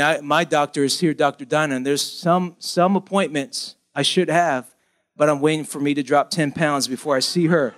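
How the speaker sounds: ordinary delivery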